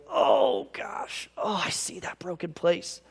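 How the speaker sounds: heartbroken, seeing the wound; the Spirit pained for him; aching compassion. Acted. Spirit voiced with deep compassion.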